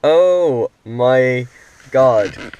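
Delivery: excited. Real, not acted. Car screen just worked.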